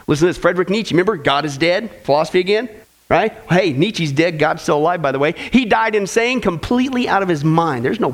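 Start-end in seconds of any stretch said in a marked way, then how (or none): none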